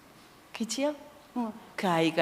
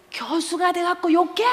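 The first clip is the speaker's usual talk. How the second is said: high, put-on accusing voice; mimicking student; 9 semitones higher than usual. Imitating students who scold the professor for swearing, high put-on accusing voice. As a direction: do the cheeky student scolding back. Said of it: "이래".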